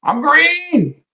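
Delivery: high singsong I'm green; gleeful brag. Real, not acted. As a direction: crow it happily. Bragging about green accounts.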